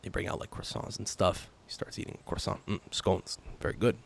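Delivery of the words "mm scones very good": low, soft, contented dad murmuring over food; father's deeper voice. Retold or imitated. Son imitating his father eating breakfast, low and soft. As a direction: savour each bite in a mellow, deeper dad voice. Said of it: "he has a d-, deeper voice, too".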